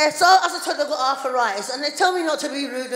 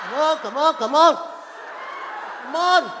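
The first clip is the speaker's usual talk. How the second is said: raised, insistent hurrying; impatient urging; 4 dB louder than usual. Retold or imitated. Wife missing EastEnders hurrying husband, loud impatience. Same urging, escalating louder.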